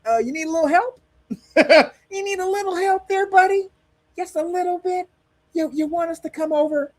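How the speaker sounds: high, mock-coaxing talk to a child; condescending America. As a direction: coax like talking down to a kid. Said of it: "what America is telling Mexico".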